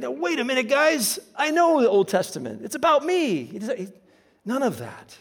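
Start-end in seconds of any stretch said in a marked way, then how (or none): 0.0-3.4 high, lightly voiced eager protest; mock excited Jesus. Preacher imagining Jesus protesting to the crowd, higher and eager. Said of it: "he's like".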